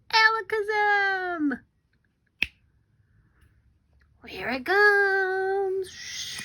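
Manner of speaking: high, loud and slow spell; magic spell. Shown as a demonstration. Teacher leading the class's magic word, high and drawn out. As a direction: cast it with big suspense. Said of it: "ready on my snap, say".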